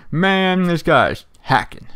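slow imitated southern drawl, grumbling; mock the southern player in his accent. Imitating an angry southern free-for-all player, slow southern accent. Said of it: "like, a southern accent, a real deep voice".

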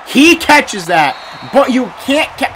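loud, high burst at the game; yelling at the screen; sudden loud outcry; frustrated yell. Real, not acted. Shouting at a dropped catch.

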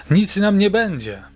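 put-on a girl fires back angrily at the old witch; angry shout back. A narrator's reading. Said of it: "odkrzyknęła Sandra ze złością".

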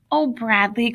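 usual speaking voice

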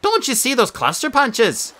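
stubborn Beckett. A narrator's reading. Beckett keeps arguing with brother.

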